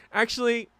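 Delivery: ordinary talk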